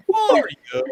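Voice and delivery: a quick stab at Dennis Leary; Dennis Leary impression. Shown as a demonstration. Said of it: "Dennis Leary".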